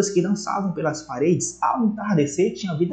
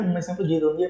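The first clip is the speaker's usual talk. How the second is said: usual speaking voice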